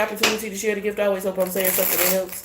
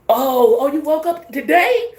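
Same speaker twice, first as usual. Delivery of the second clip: high, loud mock-shocked gossip; mock the plotters, shrill with fake alarm; 11 semitones higher, 4 dB louder than usual. Plotters in a group chat, high mock-shocked gossip. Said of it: "Imagine them being in a group chat".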